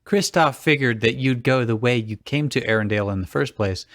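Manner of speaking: ordinary talk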